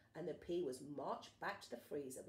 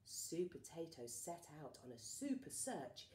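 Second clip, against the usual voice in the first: ordinary delivery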